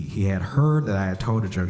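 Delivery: usual speaking voice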